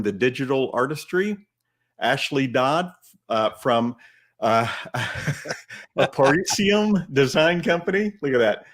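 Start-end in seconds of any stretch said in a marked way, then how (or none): 6.0-8.1 confident. Real, not acted. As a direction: confidently pronounce an unfamiliar name, pitched up. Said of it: "said it with a lot of confidence".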